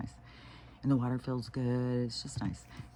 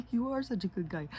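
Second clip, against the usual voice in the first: put-on much higher sing-song baby talk; cooing praise; 9 semitones higher than usual. Aunt praising the upset grandson, high cooing baby talk. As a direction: coo at a little boy to comfort him.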